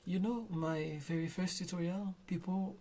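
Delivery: ordinary talk, nothing marked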